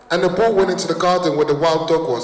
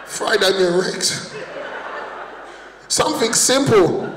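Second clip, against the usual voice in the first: mock praying; 2 semitones higher, 4 dB quieter, slower than usual. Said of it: "I'll just start crying".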